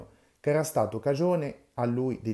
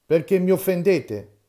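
loud, high furious demand; enraged; 7 semitones higher, 9 dB louder than usual. Acted. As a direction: hurl the question in a rage. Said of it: "gli disse imbestialito".

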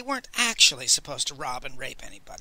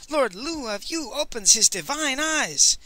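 high-pitched excited announcement; excited cry; 8 semitones higher than usual. A narrator's reading. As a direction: proclaim it with awe. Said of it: "they shrieked at the tops of their lungs".

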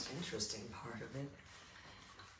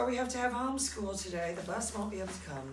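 put-on excited kid voice; 8 semitones higher, 4 dB louder, faster than usual. Voices kids about homeschool.

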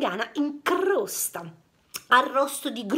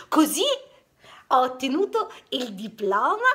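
ordinary delivery, nothing marked

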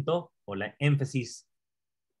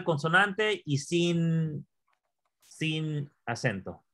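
slowly spelling out the rule; slow drill; slower than usual. Shown as a demonstration. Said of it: "solo memoriza eso". (regla dictada despacio)

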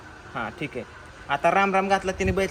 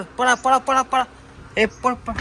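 loud hurried shouts of "पळा"; urgent calls to run; 4 semitones higher, 5 dB louder, slower than usual. Real, not acted. Crew fleeing, shouting at the others to run. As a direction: call everyone to run, fast.

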